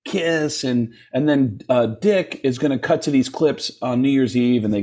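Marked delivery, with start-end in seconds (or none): 0.0-0.6 lightly voiced interviewer, pitched high; a hint of an eager TV producer, higher than usual; upbeat producer.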